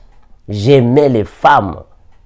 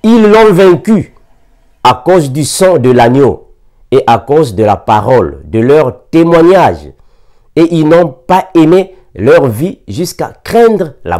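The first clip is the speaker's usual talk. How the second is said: raised, solemn proclaiming of the verse; scripture reading; 4 semitones higher, 4 dB louder than usual. Acted. Preacher reading scripture to the church, proclaiming loudly. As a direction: declaim the Bible verse to the congregation. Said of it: "La Bible dit ainsi".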